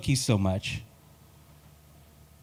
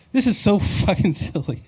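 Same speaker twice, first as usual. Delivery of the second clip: usual speaking voice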